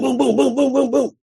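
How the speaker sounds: loud, high rhythmic booms; thudding sound effect by mouth; imitated footsteps. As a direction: pound out heavy feet trampling downstairs. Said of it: "It's my son trampling down the steps".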